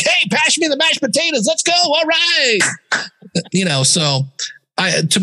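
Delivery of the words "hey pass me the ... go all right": high, full-blast hype voice for small talk; absurdly pumped-up announcer send-up; over-hyped podcaster. Retold or imitated. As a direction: parody a host who can't switch off. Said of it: "is he gonna be like".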